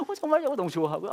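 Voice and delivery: squealing high with joy; imitating a thrilled girlfriend; delighted. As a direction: girlfriend hearing he is coming, thrilled. Wife's delighted reply on phone.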